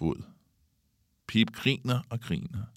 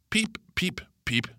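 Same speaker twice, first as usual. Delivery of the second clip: very high bird cheeps; calling out chirps; 11 semitones higher than usual. A narrator's reading. Kalle taunting Pip as she chases him, high chirps. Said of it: "råber Kalle".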